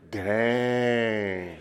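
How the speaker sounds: loud, high, long-drawn exclamation; hammed-up shock. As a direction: stretch it out in mock amazement. Hammed reaction fed to congregation.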